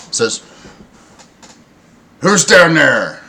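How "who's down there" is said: loud, drawn-out lookout's call. Acted. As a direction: holler it slowly from up high. Lookout calls down from crow's nest.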